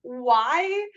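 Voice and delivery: high-pitched mock plaintive protest; retold near-crying. Cosplayer protesting the cop's order, mock tearful. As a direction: plead it high, as if about to cry. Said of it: "almost crying because I'm like".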